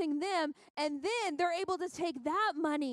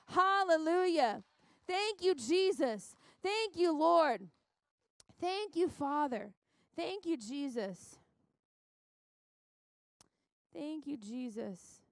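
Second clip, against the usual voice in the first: prayerful praise; slower than usual. Real, not acted. Pauses preaching to give thanks.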